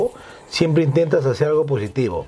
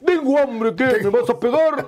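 put-on higher, pleading kid's voice; childish pleading voice; 7 semitones higher than usual. Imitating a hungry kid who begs for food, childish pleading. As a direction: play the hungry kid daring you.

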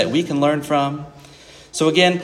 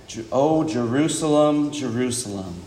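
lament; 4 semitones lower, 4 dB quieter than usual. Retold or imitated. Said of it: "there's this sadness".

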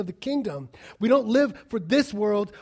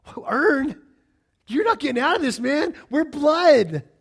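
raised pitch, loud and insistent; indignant protest; 7 semitones higher, 7 dB louder than usual. Acted. Father answering son who wants to earn love, indignant. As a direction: exclaim it, building to the final words. Acted reply to son earning relationship.

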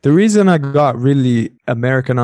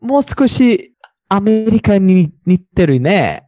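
high, hesitant polite correction; exaggerated polite doubt; Japanese students; 8 semitones higher than usual. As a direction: imitate a hedging Japanese listener, pitched up. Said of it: "And they're like".

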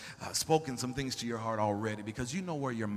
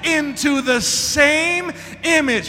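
high, loud and slow, each phrase drawn out; full-force scripture reading; 13 semitones higher, 19 dB louder, slower than usual. Acted. Preacher proclaiming Corinthians to the church, slow and loud. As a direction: proclaim it word by word.

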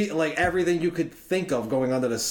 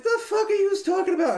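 incredulous; 3 dB louder than usual. Retold or imitated. Family says house haunted.

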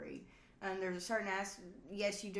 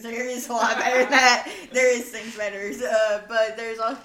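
laughing; 3 semitones higher, 14 dB louder than usual. Real, not acted. Laughing correction.

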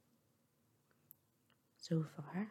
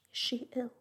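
higher, louder worried question; a mother's quick, raised question of concern; concerned cry; 6 semitones higher, 5 dB louder than usual. A narrator's reading. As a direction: press anxiously for an answer. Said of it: "cried Missus Dashwood as she entered".

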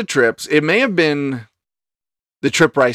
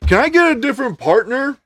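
high, teasing complaint; joking complaint; 11 semitones higher than usual. Retold or imitated. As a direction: tease like an uncle joking. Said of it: "he's like".

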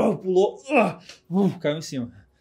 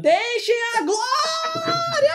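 much higher, slow drawn-out scream; screamed war cry; 4 dB louder, much slower than usual. Pretend. GM voices an NPC screaming a battle cry. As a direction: scream it like a charging berserker. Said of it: "você escutava ele gritando".

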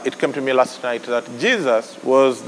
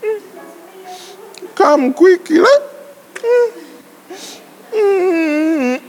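higher, whiny mock prayer; mock lazy prayer; 13 semitones higher, slower than usual. Preacher mocking a lazy believer praying lying down, higher whine. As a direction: imitate a lazy believer calling Jesus. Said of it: "and be like".

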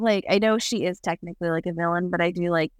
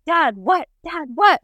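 mock yelling; 9 semitones higher, 4 dB louder, slower than usual. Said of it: "and it's like".

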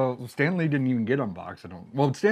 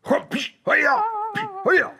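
high loud karate shout; kung fu cry; 5 dB louder than usual. Pretend. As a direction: shout it like a ninja attacking. Ninja toy kung fu cry.

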